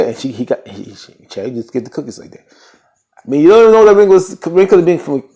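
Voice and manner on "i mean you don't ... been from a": high, loud opening, easing off; heated emphasis. Real, not acted. Doubting where Charity's ring came from, heated emphasis. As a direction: push it out loudly, incredulous.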